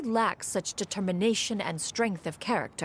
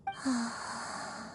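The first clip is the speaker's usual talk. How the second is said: a long, soft, airy sigh as she sinks into sleep; 4 semitones higher, 6 dB quieter than usual. Acted. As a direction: let out a drowsy sigh and fade. Yuendai drifting into deep reverie.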